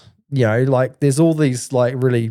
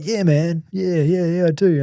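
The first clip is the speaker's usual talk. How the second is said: higher, laid-back mock dealer voice; street dealer; 6 semitones higher than usual. Camo guy confirming he can get weed, in a high mock voice. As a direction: lift the voice and play it eager.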